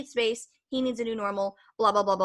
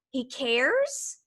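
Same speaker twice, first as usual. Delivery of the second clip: pitched up, astonished and touched; surprised disbelief; 5 semitones higher than usual. Retold or imitated. She is stunned that her crying boyfriend cares. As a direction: sound amazed that he cares.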